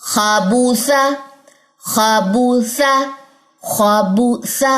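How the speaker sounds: खाबूसा very slowly, heavy kha, thin ba and sa; slow recitation drill. Shown as a demonstration. Teacher repeating the word for practice. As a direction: drag out खाबूसा, full on kha. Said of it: "अब खा यहाँ पे मोटा है".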